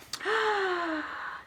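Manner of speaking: soft startled gasp; quiet shocked intake. Acted. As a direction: gasp in dismay. Reacts to Horton sold.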